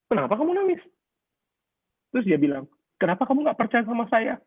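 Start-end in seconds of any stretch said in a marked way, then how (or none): 0.1-0.8 high-pitched baffled question; bewildered. Retold or imitated. Husband confused by his crying wife, asking in high bewilderment. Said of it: "Terus saya bingung". 2.9-4.4 high-pitched mock-hurt wife; imitated tearful complaint. Crying wife reproaching her husband, imitated high and plaintive. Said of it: "dia nangis".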